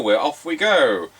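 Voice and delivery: ordinary talk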